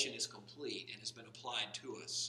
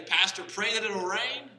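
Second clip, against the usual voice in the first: mock farmer pleading; 8 semitones higher, 12 dB louder, slower than usual. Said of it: "They'll tell you".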